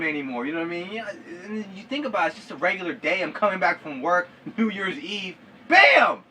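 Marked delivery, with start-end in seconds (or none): 5.7-6.2 sudden loud high "Bam!"; loud impact cry. Acted. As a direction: shout it like the crash just hit.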